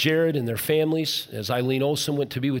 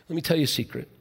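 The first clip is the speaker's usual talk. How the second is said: ordinary delivery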